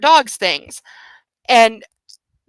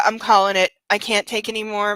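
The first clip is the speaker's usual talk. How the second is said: ordinary talk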